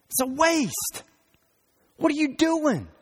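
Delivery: a sharp, disbelieving scold. Retold or imitated. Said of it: "And they scolded her".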